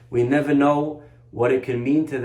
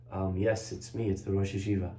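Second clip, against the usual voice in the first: put-on much softer, lower, gentle old rabbi; soft rabbi reply; 6 semitones lower, 9 dB quieter than usual. The rabbi quietly answering the boy through the door.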